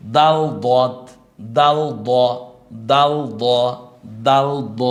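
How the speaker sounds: দাল and দ drawn out slowly and loudly. Shown as a demonstration. Teacher drilling letters for students to repeat. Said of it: "আপনি কয়েকবার উচ্চারণ করুন".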